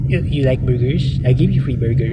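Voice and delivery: put-on mock president voice; McDonald Trump. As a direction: play the president as a burger pusher. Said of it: "that's the way he speaks".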